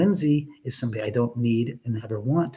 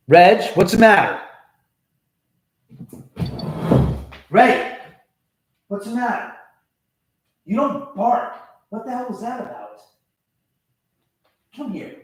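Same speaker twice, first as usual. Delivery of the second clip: higher, louder coaxing dog-talk; 8 semitones higher, 7 dB louder than usual. Real, not acted. Owner asks barking dog what's wrong, higher coaxing voice. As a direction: ask the dog what's wrong, sing-song.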